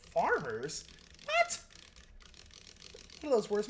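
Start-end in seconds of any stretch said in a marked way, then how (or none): 1.2-1.6 sudden high-pitched what; a squeak of disbelief at the comment; baffled what. Real, not acted.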